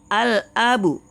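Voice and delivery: al-abu, clear Arabic reading; Arabic word read out. Shown as a demonstration. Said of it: "kita mulai baca".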